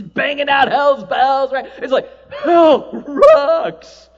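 drawn-out, loud, laughing rock cry; slow, hammed-up rocker whoop; rocking hell cheer. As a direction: sell hell like a rock anthem.

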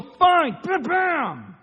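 loud, high fed-up outburst; irritated snap. Retold or imitated. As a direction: strike it out in irritation. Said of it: "you go".

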